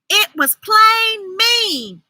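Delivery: high, slow hurt scold; hurt reproach. A narrator's reading. Ruthie Mae telling Libby off, hurt. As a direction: lean on plain, stung.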